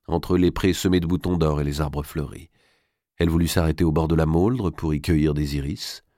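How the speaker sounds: usual speaking voice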